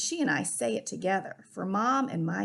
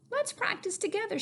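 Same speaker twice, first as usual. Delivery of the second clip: lightly voiced kindly great aunt, a bit higher; great aunt's voice; 11 semitones higher than usual. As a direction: encourage the nervous child gently. Great aunt encourages nervous child.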